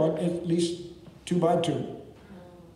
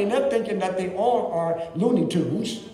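ordinary delivery

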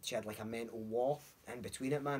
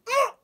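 sudden high, loud "ugh"; re-enacted cringe outburst; pained groan; 15 dB louder than usual. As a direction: blurt out a pained noise. Acting out reliving a bad gig.

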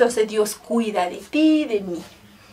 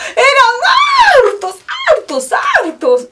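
excited repetition; 13 semitones higher, 6 dB louder than usual. A narrator's reading. Miracle climax.

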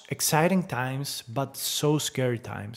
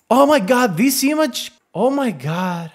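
higher and louder, gasping at it; amazed; 9 semitones higher, 7 dB louder than usual. Real, not acted. Vlogger marvels at the new image, loud and amazed.

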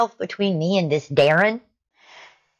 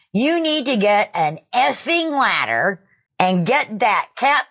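retold yelling; 7 semitones higher than usual. Karen yelling at a shopper she takes for staff, retold as mock yelling. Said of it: "started yelling".

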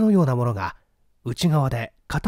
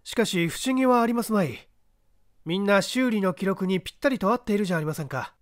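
calm, assured voice of the detective, a touch higher than the narration; a lightly voiced, unhurried gentleman sleuth; composed gentleman detective; 6 semitones higher than usual. The detective calmly directing the man up the ladder.

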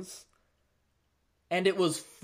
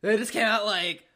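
ordinary talk

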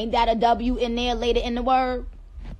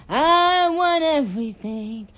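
high, drawn-out, loud demand; playful loud wail; 5 semitones higher, 6 dB louder than usual. Real, not acted. Streamer demanding her country's spices, wailing it long and loud. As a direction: belt it out slowly, pitched up.

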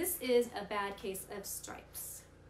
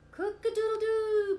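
high, loud crow; rooster crow; 13 semitones higher, 10 dB louder than usual. Acted. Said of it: "crowed Rooster".

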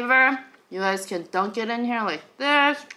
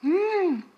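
high pleased mmm while chewing; savoring hum with mouth full; 7 semitones higher, 5 dB quieter than usual. Real, not acted. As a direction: hum with delight over food. Tasting food.

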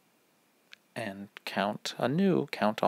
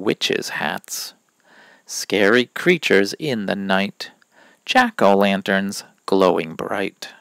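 put-on bouncy, loud sing-song verse for children; sing-song rhyme for little ones; rhyme reading; 7 dB louder than usual. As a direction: read the poem out like a show for kids. Reads seasonal rhyme to kids.

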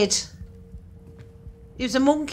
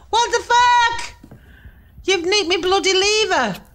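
outraged; 6 semitones higher, 4 dB louder than usual. Real, not acted. Lever stolen in game.

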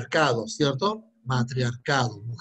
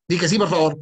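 louder, begging; exasperated loud begging; loud plea; 7 dB louder than usual. Shown as a demonstration. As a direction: call out the plea.

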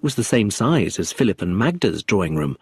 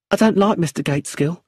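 nervous widow; 8 semitones higher than usual. Acted. Brenda refusing lawyer, nervous widow.

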